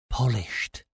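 softly dropped word after a pause; hushed dread. A narrator's reading. Stellos names the punishment to the narrator, hushed. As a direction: let the dreaded word fall quietly.

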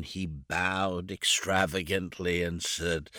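usual speaking voice

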